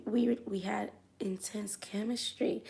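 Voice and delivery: soft secret whisper. Retold or imitated. Dancer whispering to the customer about their chemistry. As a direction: breathe it like a confession. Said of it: "I was like".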